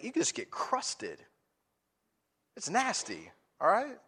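loud, driving sermon delivery, pitch up; fired-up pastor pressing the point home; preaching at full force. Acted. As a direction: preach it hard to the congregation.

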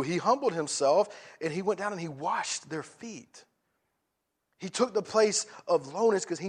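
loud, driving sermon delivery, pitch up; fired-up pastor pressing the point home; preaching at full force. Acted. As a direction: preach it hard to the congregation.

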